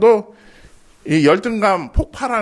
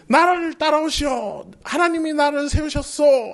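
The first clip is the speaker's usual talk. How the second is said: grand leader voice; 8 semitones higher than usual. Shown as a demonstration. How a leader should address the people, stately and grand. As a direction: proclaim 나를 따라오시오 like a confident leader. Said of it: "멋지게 말해야 되는데".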